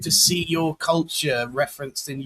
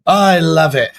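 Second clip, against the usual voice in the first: louder, more excited repeat of I love it; 4 semitones higher, 12 dB louder than usual. Real, not acted. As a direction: really belt out I love it. Host repeating his reaction, louder.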